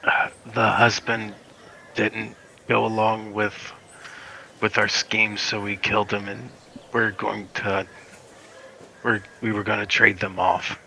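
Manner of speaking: lightly acted captive, soft and halting; subdued captive. Captive confessing to the interrogator, subdued. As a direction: answer quietly and reluctantly under interrogation. Game master plays beaten captive.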